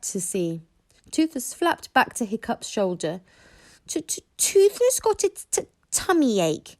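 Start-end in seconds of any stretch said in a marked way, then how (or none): 3.9-6.7 high, stuttering little dragon whine; stuttering complaint. A narrator's reading. Toothless complaining to Hiccup, high stuttering whine. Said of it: "he complained".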